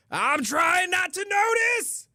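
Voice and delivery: high, slow, drawn-out exasperated shout. Retold or imitated. Streamer telling chat what he keeps saying, high slow exasperated shout. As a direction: cry it out, fed up with it all. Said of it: "and I keep going".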